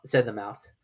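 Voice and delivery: ordinary delivery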